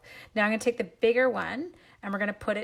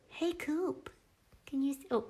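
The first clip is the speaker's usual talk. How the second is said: doggy talk; 6 semitones higher, 5 dB quieter than usual. Real, not acted. Greeting the puppy Coop, high soft doggy talk. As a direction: coo it up high at the pup. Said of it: "Why do I talk like an idiot when there's a dog?".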